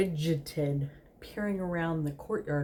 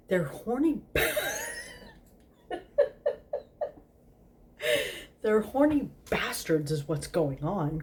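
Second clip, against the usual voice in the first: exclaimed, laughing; 6 semitones higher than usual. Acted. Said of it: "Crawley exclaimed".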